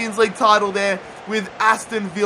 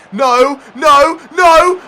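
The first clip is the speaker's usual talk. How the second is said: rising, louder no's as the danger grows; frantic higher no no no; panicked shouts; 6 semitones higher, 9 dB louder, much slower than usual. Real, not acted. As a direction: yell no as the ball flies in. Opponent shot about to score.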